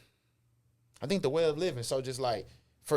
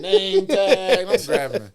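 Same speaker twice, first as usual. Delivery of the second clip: excited; 7 semitones higher, 13 dB louder, much slower than usual. Retold or imitated. Excited list of grabbed gear.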